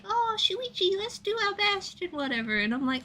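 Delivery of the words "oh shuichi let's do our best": much higher but softer sweet encouragement; Kaede cheering. Retold or imitated. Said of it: "she's like".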